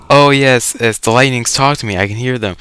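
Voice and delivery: ordinary delivery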